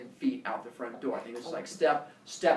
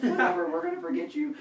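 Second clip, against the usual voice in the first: mock careless kid; 10 semitones higher than usual. Brother brushing off.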